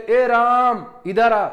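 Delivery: stretched call ए राम इधर आ with raaaam held long; calling out a name. Shown as a demonstration. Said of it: "आप किसी व्यक्ति को आवाज दे रहे हो".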